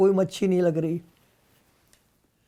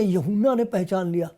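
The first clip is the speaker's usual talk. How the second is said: ordinary delivery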